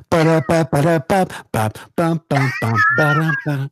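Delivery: put-on scat the old Mario Brothers tune; mouth-music video game jingle; playful ba-da-ba game melody; hummed Mario theme. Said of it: "the music from Mario Brothers".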